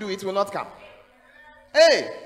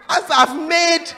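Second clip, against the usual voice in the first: building indignant shout; 12 dB louder, slower than usual. Acted. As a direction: pile it up, slower and louder to the punchline. Preacher building comic outrage.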